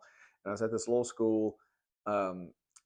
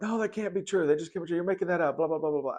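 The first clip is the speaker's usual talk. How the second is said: put-on higher, louder indignant protest from fifth grade boys; mocking skeptical kids; 8 semitones higher, 4 dB louder, faster than usual. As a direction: mimic the kids scoffing at the story, pitched way up. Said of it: "because they were like".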